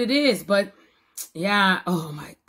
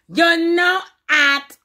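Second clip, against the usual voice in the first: catchphrase; 7 semitones higher, 3 dB louder, slower than usual. Real, not acted. Catchphrase hype.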